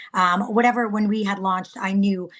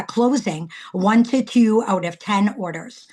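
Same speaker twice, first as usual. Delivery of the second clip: usual speaking voice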